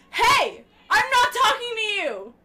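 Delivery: loud playful shout. Real, not acted. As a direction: call out loud in mock protest. Yelling at partner off mic.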